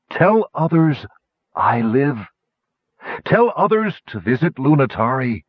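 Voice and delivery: lightly voiced dragon calling last wishes; failing dragon. A narrator's reading. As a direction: call out in short, labored phrases. Dragon calls last wishes from afar.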